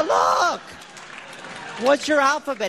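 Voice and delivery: high, drawn-out "Look"; emphatic challenge. Retold or imitated. Kid setting the man straight, high emphatic challenge. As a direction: pitch it up sharply to demand attention.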